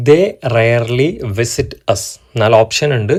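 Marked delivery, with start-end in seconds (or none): 0.1-2.0 very slow, every word spaced out; slow dictation reading. Shown as a demonstration. Teacher reading a practice question to viewers, very slowly. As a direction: set the exercise sentence out slowly. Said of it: "ചെയ്തു നോക്കാനുള്ളൊരു ചോദ്യമാണ്".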